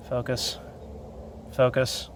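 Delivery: muttered under breath; quietly urging the camera; quiet muttering. Real, not acted. Urging phone camera to focus.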